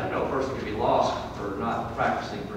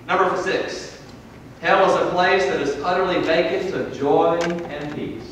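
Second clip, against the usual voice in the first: loud, raised pulpit delivery; forceful preaching; 8 semitones higher, 8 dB louder than usual. Real, not acted. Preacher voicing the rich man's plea and lesson, forceful preaching. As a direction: drive each statement home from the pulpit.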